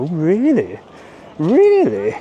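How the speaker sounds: mock posh friend. Posh friend hears about the Del Duque bag, mock posh. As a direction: swoop up on it like a posh acquaintance. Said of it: "thinking of a posh name".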